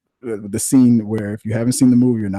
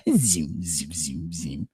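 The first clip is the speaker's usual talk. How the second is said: lightsaber sound effect; 4 semitones lower, 7 dB quieter, much slower than usual. Retold or imitated.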